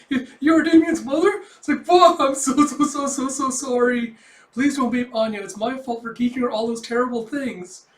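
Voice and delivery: loud, high, stammering panic; freaking out. Acted. Yor apologizing to Damien's mother, frantic acted panic. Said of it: "Poor Yor's freaking out".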